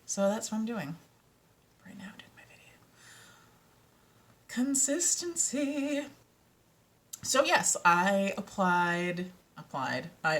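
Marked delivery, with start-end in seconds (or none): 1.8-2.8 very soft, voiceless whisper; whispered aside. Real, not acted. Telling viewers she is filming right now, whispered. 4.5-6.1 high, slow, stretched-out word; mock sing-song. Teasing herself over the forgotten video, high sing-song.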